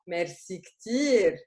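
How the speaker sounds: "merci ktir" drawn out slowly, stress on ktir. Shown as a demonstration. Teacher models thank you very much, slowly. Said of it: "So we say a lot".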